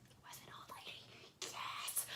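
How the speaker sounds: hushed excited whisper; kids whispering. Retold or imitated. Kids whispering in delight at the book. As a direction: whisper it like a thrilled kid. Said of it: "they're like".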